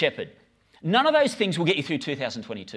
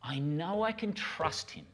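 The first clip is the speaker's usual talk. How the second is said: quiet sincere; 9 dB quieter, slower than usual. Real, not acted. Heartfelt testimony.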